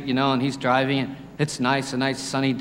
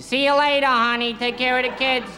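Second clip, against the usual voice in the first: higher, gruff-sounding truckie saying goodbye; truck driver voice; 8 semitones higher than usual. Retold or imitated. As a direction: play a burly trucker leaving for work. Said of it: "all truck drivers talk like that".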